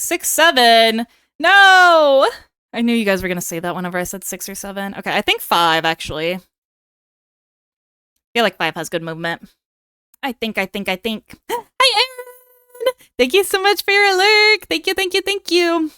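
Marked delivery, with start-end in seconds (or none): 1.4-2.3 loud protest. Real, not acted. Chat pushes six seven joke. 11.5-15.9 high squeaky excited greeting; bubbly high-pitched VTuber welcome; cute anime streamer. Acted. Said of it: "put on a little fake VTuber voice".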